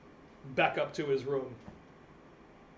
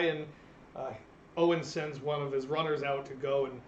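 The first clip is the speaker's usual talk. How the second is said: usual speaking voice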